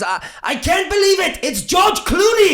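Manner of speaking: loud, high, drawn-out exclamation; excited mock shout. New signing announcing himself to the reserves, excited shout. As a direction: shout it like a big entrance. Acted star's loud arrival greeting.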